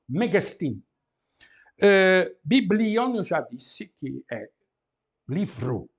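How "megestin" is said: Megestin as one word, mega and estin fused with no break; run together. Shown as a demonstration. A teacher shows students to read Megestin all together. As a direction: join it into Megestin. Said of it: "tudo junto".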